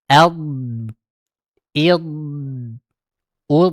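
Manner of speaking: Ad, id, ud said as the correct demo; correct Arabic vowels. Shown as a demonstration. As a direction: clean short vowels ad id ud. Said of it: "harus yang benar". (contoh bacaan yang benar)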